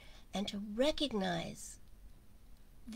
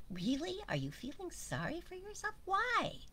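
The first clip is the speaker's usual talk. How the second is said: high, chiding little bird voice; tut-tut at someone feeling sorry for themselves; a high chirpy scolding bird; hummingbird's voice; 10 semitones higher than usual. Retold or imitated. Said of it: "the hummingbird says".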